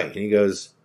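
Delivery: usual speaking voice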